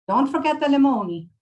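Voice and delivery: put-on high, loud Italian-accented reminder; bossy old Italian woman's accent; Maria, Italian accent. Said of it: "I'm going to butcher an Italian accent".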